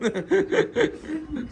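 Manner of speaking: amused high burst of laughter; laughing at the cousin's cement remark; high, hearty laugh. Real, not acted. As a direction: laugh it out at the joke. Laughing at guest's joke.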